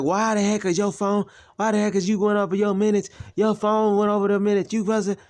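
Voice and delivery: mock angry mother. Mother yells at son over phone minutes. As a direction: scold him in her high voice. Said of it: "She's like".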